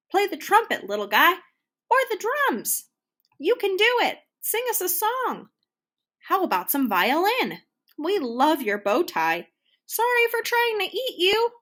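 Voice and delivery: high, loud calling from the crowd. A narrator's reading. Audience shouts encouragement to Mac, loud and high. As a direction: call out cheers to the stage.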